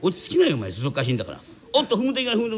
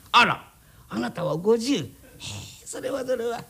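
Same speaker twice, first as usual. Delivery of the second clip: mock surprise; 7 semitones higher than usual. Flattering a stranger's age.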